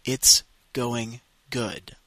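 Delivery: "It's going good." drawn out very slowly; very slow, clear repeat-after-me model. Shown as a demonstration. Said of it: "Répétez".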